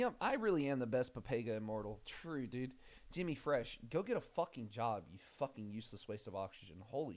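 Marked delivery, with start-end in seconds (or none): none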